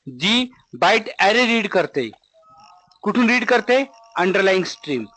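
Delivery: ordinary delivery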